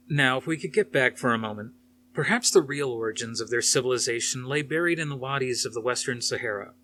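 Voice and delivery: plain reading voice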